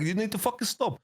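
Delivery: high sharp mock-angry order; retold angry command. To Khaled in boot camp, a mock angry order. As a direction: snap at him to stop. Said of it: "I get angry".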